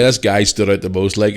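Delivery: usual speaking voice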